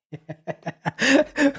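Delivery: high burst of real laughter; sudden amused cackle. Real, not acted. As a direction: break out laughing at the chat. Reading a mocking chat message.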